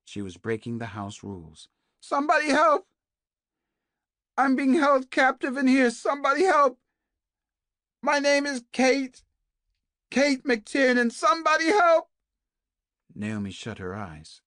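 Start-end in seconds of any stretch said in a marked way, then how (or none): 2.0-12.1 much higher and louder, screaming for help; desperate scream. A narrator's reading. Captive woman screams through the walls for help, full scream. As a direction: scream it at the top of your lungs. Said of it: "screaming at the top of her voice".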